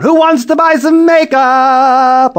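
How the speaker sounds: high, loud, slow hawker yell; calling out. Acted. Julie calling to the neighborhood, high loud shout. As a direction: shout it out the window. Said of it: "leaned out the window and yelled".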